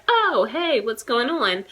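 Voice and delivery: breezy high "Oh, hey" dropping into an easy question; relaxed, chummy hello; casual American greeting. Shown as a demonstration. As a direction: toss it off like greeting an old friend. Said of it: "we're just like".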